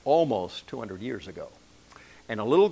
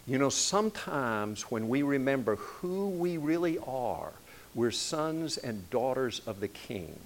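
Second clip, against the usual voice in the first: ordinary talk, nothing marked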